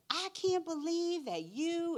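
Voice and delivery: high, loud and slow, a scolding mother's outburst; exasperated mother. Retold or imitated. Mother scolding her son's teacher on the phone, loud exasperated disbelief. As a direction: let the disbelief burst out high and drawn out.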